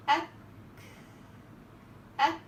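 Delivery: أك with a stop released softly into a breath; breathy kaf. Shown as a demonstration. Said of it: "ضعف اعتماد".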